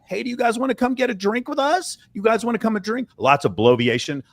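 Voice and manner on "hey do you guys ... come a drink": high, eager-to-please invitation; voice going up. Retold or imitated. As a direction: mimic a man pitching his voice way up. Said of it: "your voice goes up".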